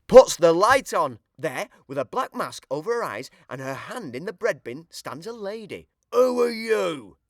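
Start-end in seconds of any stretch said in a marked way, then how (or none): 6.1-7.2 louder, slow challenge; a caught-out burglar demanding; Burglar Bill startled. A narrator's reading. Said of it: "says Burglar Bill".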